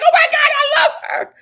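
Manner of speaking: high loud gush of admiration; loud adoring exclamation; delighted shout. Real, not acted. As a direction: shout it like a thrilled fan. Gushing reaction to Xena.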